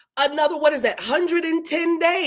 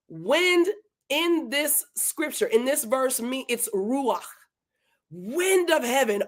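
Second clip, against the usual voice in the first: ordinary talk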